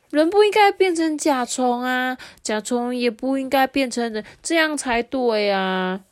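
put-on emphatic storybook child; insistent young friend. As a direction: voice a boy insisting to his friend.

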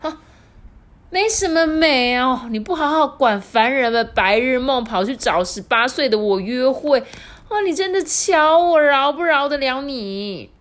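high, loud telling-off; old wife threatening her husband; scolding old wife. Acted. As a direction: scold him to hide her blush. Wife scolds, flattered yet angry.

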